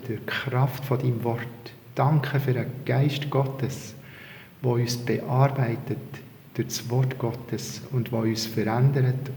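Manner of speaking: hushed, low-key prayer in Swiss German; quiet, reverent murmured prayer. Real, not acted. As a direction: pray softly and humbly to the Lord. Opening prayer in dialect.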